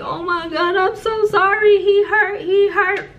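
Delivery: high, wailing re-enacted sobby apology; crying. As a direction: wail it out loud as if crying hysterically.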